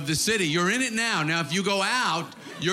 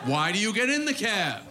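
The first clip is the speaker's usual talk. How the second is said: ordinary talk, nothing marked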